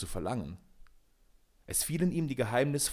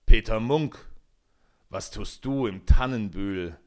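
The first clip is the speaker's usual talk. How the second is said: deep booming forest king; 6 dB louder than usual. A narrator's reading. Forest king challenges Peter, deep booming voice. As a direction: boom it out like an ancient forest ruler. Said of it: "mit tiefer, dröhnender Stimme".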